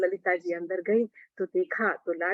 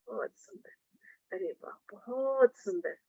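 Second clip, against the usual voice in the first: breathy, much softer, slow marvelling; hushed awe; 7 dB quieter than usual. A narrator's reading. Breathe it out in wonder.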